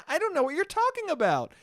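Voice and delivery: high, laughing, mock-innocent whine. Mocking a friend dodging the fine, in a high, laughing, innocent voice.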